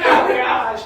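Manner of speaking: a sudden loud "Oh my gosh" through laughter; loud laughing groan of embarrassment; embarrassed shout. Retold or imitated. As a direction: blurt it out, mortified and laughing. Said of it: "I was like".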